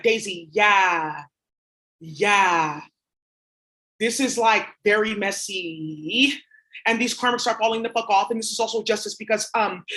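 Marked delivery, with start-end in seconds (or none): 0.0-6.4 mock drawn-out commenter.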